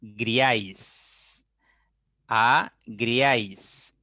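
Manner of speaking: very slow, syllable by syllable; agriáis said as a-griáis in slow separate beats; agriáis broken into two slow syllables; split into syllables. Shown as a demonstration. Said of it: "separan esta palabra en sílabas".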